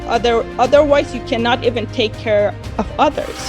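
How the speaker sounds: normal talking voice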